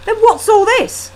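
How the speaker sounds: high, loud challenge; heated demand. Acted. Husband confronting wife, loud and high. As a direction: demand it, rattled.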